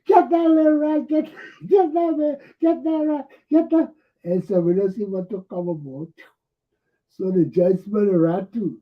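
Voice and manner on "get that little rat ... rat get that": much higher, louder mob shout; crowd yelling. Retold or imitated. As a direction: yell like a mob going after him. Crowd chasing the Solicitor General.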